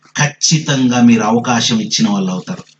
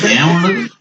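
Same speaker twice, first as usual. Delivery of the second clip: ordinary talk, nothing marked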